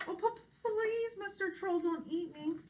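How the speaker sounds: very high, stammering plea; pleading goat. Acted. Frightened goat begs the troll, high stammering plea. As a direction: beg in a tiny trembling voice.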